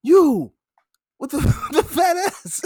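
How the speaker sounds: high, loud taunt through laughter; imitating the older player picking teams; mock taunt, laughing. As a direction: call him over, cracking up. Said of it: "He's like".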